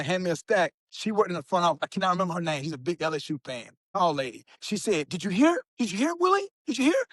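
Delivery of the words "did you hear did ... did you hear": high, rising eager questions; a coworker bursting to share big news; excited news. Retold or imitated. Coworker excitedly bringing graduation news.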